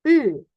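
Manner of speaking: "U" said as an O with an E inside; O-umlaut sound. Shown as a demonstration. Teacher demonstrating O umlaut, an O-E blended vowel. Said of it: "Kayak ngomong huruf O, tapi ada E-nya".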